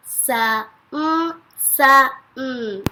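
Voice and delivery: word broken into separate sounds; sounded out. Shown as a demonstration. Teacher sounds out a word for kindergarten writers, sounded out. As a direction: say each sound apart, holding the hum.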